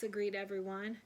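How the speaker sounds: ordinary delivery, nothing marked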